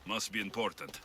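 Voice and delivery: ordinary talk, nothing marked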